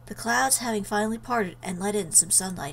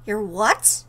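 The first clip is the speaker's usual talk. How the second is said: stunned, louder "what?" rising; shocked; 6 dB louder than usual. Acted. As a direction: blurt it out in disbelief. Shocked reply to quitting news.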